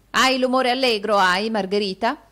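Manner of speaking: ill-humoured. A narrator's reading. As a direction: snap at the servant with poor grace. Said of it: "disse Arturo con qualche malagrazia".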